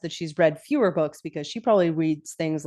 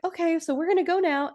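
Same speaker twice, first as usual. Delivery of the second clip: high-pitched tentative announcement; lightly acted high voice; girls making an exit; 10 semitones higher than usual. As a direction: imitate the girls in a raised voice. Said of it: "the girls are like".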